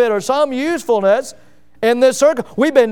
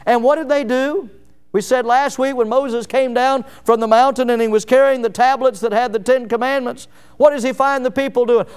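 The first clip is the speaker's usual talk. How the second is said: normal talking voice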